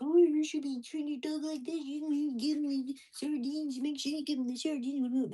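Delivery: high, loud mock-scolding voice; mocking nitpickers. Imitating people who nitpick how he feeds his dog, mocking. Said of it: "people love to nitpick everything. Be like".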